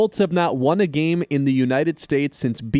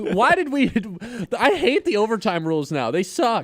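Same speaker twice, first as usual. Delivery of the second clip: mock outrage; 8 semitones higher than usual. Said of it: "I'm like".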